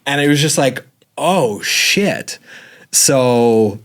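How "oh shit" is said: higher dismayed exclamation; stunned oh shit. Retold or imitated. Husband reacts to pregnancy news right after quitting, stunned. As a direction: let it fall out in shock.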